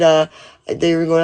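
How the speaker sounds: ordinary talk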